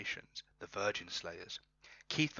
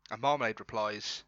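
ordinary talk, nothing marked

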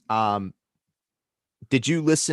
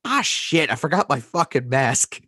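loud, higher-pitched mock dismay; retold shopper; 6 semitones higher, 3 dB louder than usual. Cashier turns away a woman without a mask, hammed-up dismay. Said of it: "the woman just goes".

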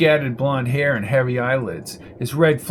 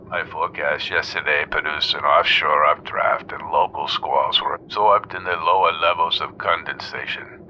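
much lower, hushed pompous expert; pompous weatherman; 9 semitones lower than usual. A narrator's reading. Dr Edinger excusing his forecast on the radio, low and pompous. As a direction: lecture in a low droning tone.

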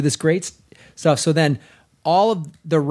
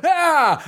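short high mock scream; retold scream; 9 semitones higher than usual. Jumping back in the theater, a high mock yelp. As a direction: yelp like jumping out of your chair. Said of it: "I went ... I was screaming".

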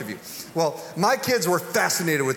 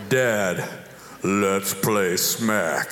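much lower, slow, menacing challenge; deep grown son challenge; 10 semitones lower, much slower than usual. Retold or imitated. Father imitates his grown son challenging him, deep and slow. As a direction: drop the voice low and drag it out like a threat. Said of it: "Except he said it like this".

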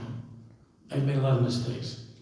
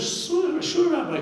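mocking; 6 dB louder, slower than usual. Mocking audience invites the rabbi.

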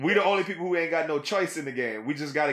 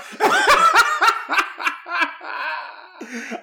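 high, loud cackling laugh; loud burst of laughter; 14 semitones higher, 7 dB louder than usual. Real, not acted. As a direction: crack up at length.

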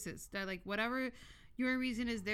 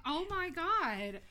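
mock shocked gasp; 9 semitones higher, 5 dB louder, much slower than usual. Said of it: "And I'm like".

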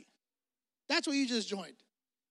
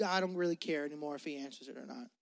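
lower, softer, fast dismissive mumble; indifferent shrug; 6 semitones lower, 8 dB quieter, faster than usual. Retold or imitated. A listener whose doubts hit a dead end, shrugging it off. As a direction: sound like you've given up caring. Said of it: "where you just".

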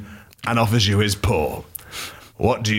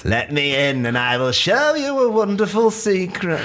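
high character voice; 10 semitones higher than usual. Acted. Script line as Rondi.

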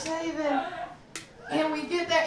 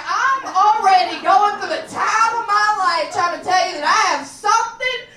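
higher, louder, slow exasperation; indignant rant; 5 semitones higher, 8 dB louder than usual. Retold or imitated. Ranting to the crowd about the doctor, indignant and loud. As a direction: vent at the doctor.

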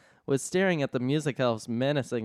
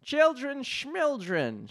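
high, slow mocking elf voice; elf shouting; 11 semitones higher, slower than usual. Rowdy elf mocking Santa, high and scornful. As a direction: sneer it in a high squeaky voice. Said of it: "shouted an elf".

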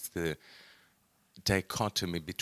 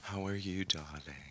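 velvet voice; 11 dB quieter than usual. Acted. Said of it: "It's this velvety voice".

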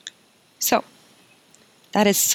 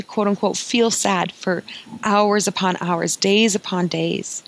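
usual speaking voice

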